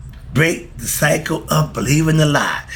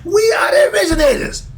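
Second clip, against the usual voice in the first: emphatic high peak; faster than usual. Real, not acted. Climax of his point.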